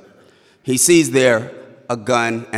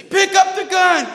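chorus of yelling MPs; 5 dB louder than usual. Retold or imitated. Chorus of MPs ordering the driver at gunpoint, screaming. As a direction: yell like a whole squad shouting orders. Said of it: "And they say".

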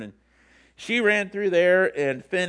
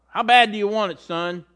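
louder, higher coach's demand; challenging coach; 5 semitones higher, 6 dB louder than usual. Retold or imitated. Speaker to football player, loud challenging coach. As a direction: push the player hard with the question. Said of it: "motivational speaker asked this".

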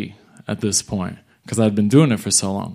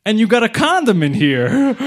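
amused chief; 10 semitones higher, 4 dB louder than usual. Retold or imitated. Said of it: "And then he's like".

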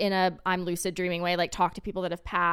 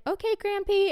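put-on childlike; 12 semitones higher than usual. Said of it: "I was just like".

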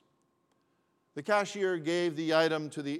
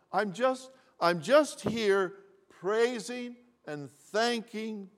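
higher, slow, overflowing with grateful emotion; tears of joy; 4 semitones higher, 5 dB louder than usual. A narrator's reading. Old lady thanks the man through tears, higher and slower. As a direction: let the joy spill over, near tears. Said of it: "with tears still streaming".

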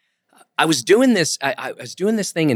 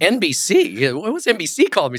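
higher-pitched mock bewilderment; puzzled surprise; 5 semitones higher than usual. Comedian retelling seeing NBC on his cell phone, puzzled. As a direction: wonder aloud, baffled, at a retold surprise.

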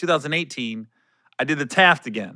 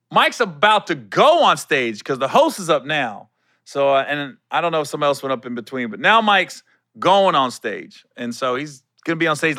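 ordinary delivery

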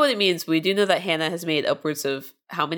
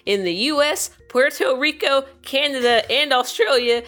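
put-on raised, hollering old miner twang; old-timey cowboy voice; 4 semitones higher, 3 dB louder than usual. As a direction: keep up the folksy cowboy miner pitch. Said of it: "my old-timey, uh, cowboy miner voice".

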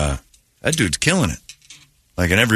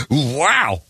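ordinary talk, nothing marked

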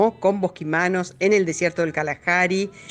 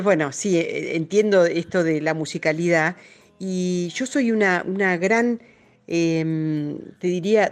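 ordinary delivery

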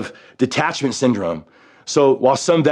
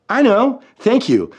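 emotional thanks; 9 semitones higher, slower than usual. Retold or imitated. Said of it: "starting to feel a little emotional".

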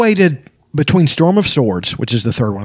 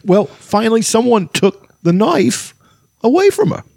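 high, slow voice with odd halting pauses; hammed-up halting actor impression; Christopher Walken; 8 semitones higher, slower than usual. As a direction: break the line up oddly, leaping up in pitch. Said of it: "And that was Christopher Walken".

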